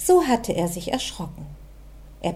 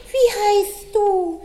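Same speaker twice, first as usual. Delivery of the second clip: high, slow puzzled bunny echo; puzzled little rabbit; 12 semitones higher, 3 dB louder, slower than usual. A narrator's reading. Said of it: "wollte der Kleine wissen".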